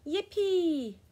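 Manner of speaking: high, loud, drawn-out cheer; gleeful cry. A narrator's reading. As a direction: let out a big whoop of joy. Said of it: "they cried".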